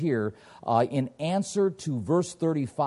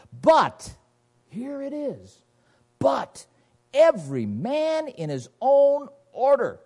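emphatic scripture reading; 2 semitones higher, 4 dB louder, slower than usual. Real, not acted. Reading the key verse emphatically.

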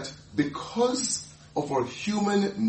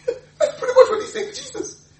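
laughing aside; 4 dB louder than usual. Real, not acted. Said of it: "laughing at Peter".

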